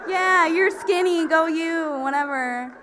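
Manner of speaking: high, slow mock-bubbly voice; mocking sign girl. Mocking the girl on the running sign, high and slow. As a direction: sneer it like a ditzy athlete. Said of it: "this bitch, and she's like".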